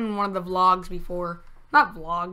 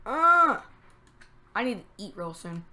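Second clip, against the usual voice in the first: ordinary talk, nothing marked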